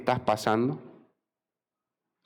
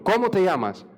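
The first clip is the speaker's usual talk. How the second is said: insistent question, pitched up then falling; stern demand; 7 semitones higher than usual. Acted. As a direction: press for an answer. God presses Jacob a third time. (pregunta insistente, en tono alto)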